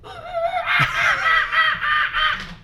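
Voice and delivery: high wild jungle animal call. Pretend. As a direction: shriek like a jungle creature. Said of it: "my best jungle impression".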